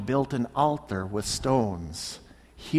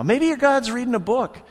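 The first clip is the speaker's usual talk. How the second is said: higher, mocking jab; sarcastic jab; 8 semitones higher, 5 dB louder, faster than usual. Elijah picking on the prophets, sarcastic and higher. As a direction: poke fun at their silent god. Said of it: "he's really picking on him now".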